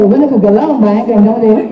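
put-on a loud, lively Bajan accent quote. Fan quoting her favorite Bajan character, loud Bajan accent. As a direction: hit it in full Bajan accent. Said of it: "He was Bajan to his heart and soul".

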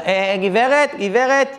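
hailing someone, a mild retold yell; mock calling out. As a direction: call after someone without really shouting. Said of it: "פתאום איזה מבוגר צועק לה".

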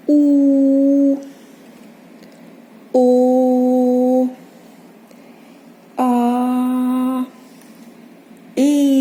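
У, О, А, Е each held long on a steady note; drawn-out vowel sounds. Shown as a demonstration. Teacher reviewing vowel sounds with children, У, О, А, Е held long. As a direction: sustain each vowel, У, О, А, Е, separately. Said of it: "Давайте повторимо, які вже звуки".

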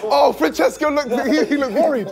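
high-pitched, laughing exclamations; laughing tease. Real, not acted. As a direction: call him out through giggles. Teasing contestants amid laughter.

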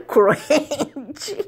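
drawn-out "Crunch" breaking into giggles; laughing. Real, not acted. Learner fumbling the sushi name for her son, laughing. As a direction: stretch the word and crack up on it. Giggles while attempting the English word.

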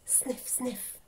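soft sniffing fox noises; quiet, suspicious fox snuffle; bristling fox. A narrator's reading. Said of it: "bristled Mister Fox".

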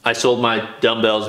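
normal talking voice

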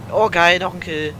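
loud excited cheer. Real, not acted. Cheering a kill.

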